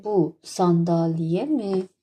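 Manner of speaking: bu sandalye mi very slow, falling at the end; slow model question. Shown as a demonstration. Teacher models the Turkish question for students, slowly. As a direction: dictate bu sandalye mi slowly, word by word. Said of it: "اول bu sandalye میره بالا".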